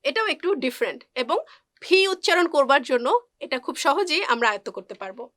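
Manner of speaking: usual speaking voice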